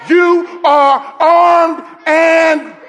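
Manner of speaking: loud, high shouted preaching; preaching at full force. Acted. Preacher shouting to the church, full force. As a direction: proclaim it to the congregation.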